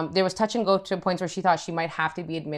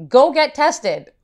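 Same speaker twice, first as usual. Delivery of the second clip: mock alarm voice; 5 semitones higher, 5 dB louder, slower than usual. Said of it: "He's like".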